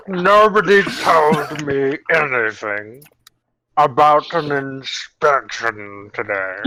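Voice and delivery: put-on very slow, stretched Vogon accent. Manager complains of not being told, slow Vogon accent. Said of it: "Uh, Vogon".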